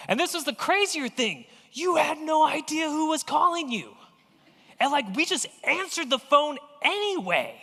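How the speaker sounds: excited exclamation. Real, not acted. Preacher exclaims phone craziness.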